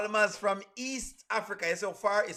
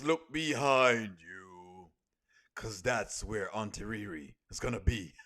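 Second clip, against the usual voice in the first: slow, drawn-out creepy warning that drops much lower at each end; ominous spooky voice; 5 semitones lower, 4 dB louder, slower than usual. Pretend. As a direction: menace the viewers like a horror movie narrator, slowing right down. Spooky warning about Auntie Riri watching.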